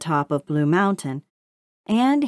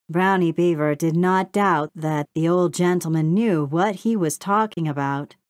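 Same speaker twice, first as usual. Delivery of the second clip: plain reading voice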